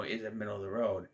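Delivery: usual speaking voice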